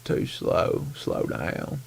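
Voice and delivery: soft mock of an old angler's advice; imitating Bill Payne. Host quoting a fishing legend, mildly imitated. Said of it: "That's how he talks".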